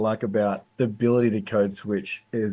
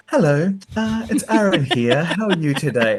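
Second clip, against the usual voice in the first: high, proper phone-greeting voice; mock formal hello; posh work voice; 8 semitones higher, 4 dB louder than usual. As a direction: put on a polite office voice. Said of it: "skits where they go from".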